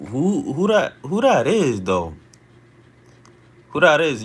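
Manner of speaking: high, loud who-is-that; startled demand. Real, not acted. Streamer spotting a troll in chat, startled and loud. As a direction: call out the stranger.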